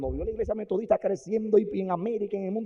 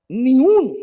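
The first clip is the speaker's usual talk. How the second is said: emphatic shout; 8 semitones higher, 14 dB louder, slower than usual. Real, not acted. Emphatic cry.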